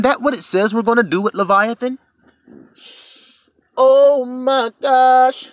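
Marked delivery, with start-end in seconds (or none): 3.8-5.5 high, very slow stretched exclamation; drawn-out oh my gosh. Acted. Preacher marvelling to the congregation, slow high drawn-out gasp. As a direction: stretch it out in mock amazement.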